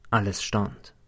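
ordinary delivery

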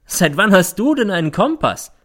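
high, loud sneering question; scornful. A narrator's reading. Mother questioning her accused son, scornful. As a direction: challenge with open derision. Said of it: "es klingt wie Hohn".

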